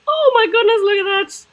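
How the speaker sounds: high, loud delighted surprise; excited gasp. Real, not acted. Child discovering a toy cupboard, loud high delight. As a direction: squeal it like you just spotted treasure.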